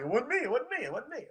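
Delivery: high, playful squeaky protest; mock denial. Chiropractor jokingly denying to the woman, high squeak. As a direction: deny it like a caught kid.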